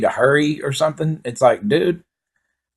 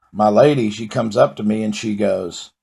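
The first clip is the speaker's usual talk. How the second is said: ordinary talk, nothing marked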